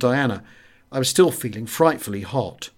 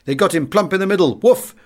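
higher and louder, bursting out on Woof; excited exclaiming; 6 semitones higher, 4 dB louder than usual. A narrator's reading. Café regulars act out the bomber falling, loudly exclaiming. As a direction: relive the plane's fall with a loud whoop. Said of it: "they expostulated".

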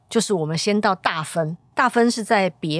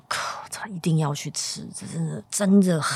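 whisper. Retold or imitated. Retold excited whisper to partner.

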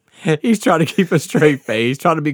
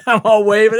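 ordinary talk

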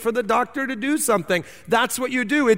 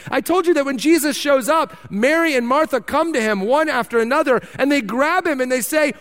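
ordinary talk

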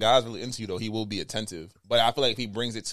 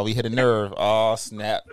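usual speaking voice

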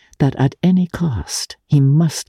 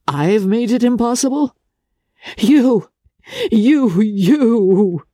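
crying out; 10 semitones higher, slower than usual. Acted. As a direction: burst out in hurt disbelief. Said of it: "she cried".

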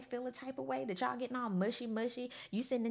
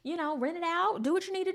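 quick, higher, eager imitation of the group; eager go-ahead; 9 semitones higher, faster than usual. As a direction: rattle off the group's eager yes. Everybody's eager approval, retold.

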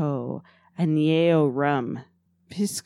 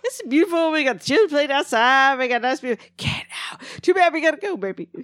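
Eddie Murphy impression; 7 semitones higher than usual. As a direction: ham up a comedian's bit. Eddie Murphy routine continued.

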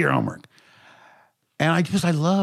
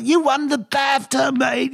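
put-on drunken producer; 12 semitones higher than usual. Drunk producer shouting news down the phone, slurred. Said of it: "He goes".

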